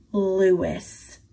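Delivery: plain reading voice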